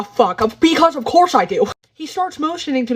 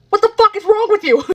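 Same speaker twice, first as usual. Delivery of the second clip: outraged yell; 9 semitones higher, 4 dB louder than usual. Real, not acted. Outraged at stranger.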